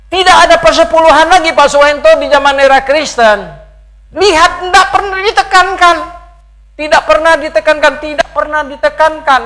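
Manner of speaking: loud, high-pitched mimicked protest; insistent objectors. Preacher quotes people objecting to tithing, loud insistent protest. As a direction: voice the objection at full force. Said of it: "banyak orang bilang apa?".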